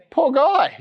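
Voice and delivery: high sympathetic coo; mock pity. Pastor pities the lame man to the congregation, high and mock-sorry. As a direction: sigh it up high, all sorry for him.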